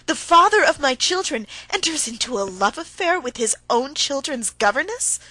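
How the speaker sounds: loud and high, accusing. A narrator's reading. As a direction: hurl it at him, voice up. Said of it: "raising her voice".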